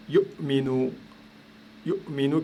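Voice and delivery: yu'minu with a catch in the throat after yu; yu'minu broken by a short silent gap; glottal stop demo. Shown as a demonstration. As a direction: say yu, stop the air, then minu in yu'minu. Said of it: "Pareil ici".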